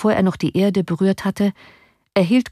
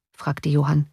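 plain reading voice

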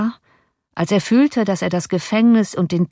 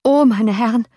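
alarmed, raised cry; higher, louder exclamation; coachman calling out; 5 semitones higher, 4 dB louder than usual. A narrator's reading. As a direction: cry out to the gentlemen in dismay. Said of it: "rief der Kutscher".

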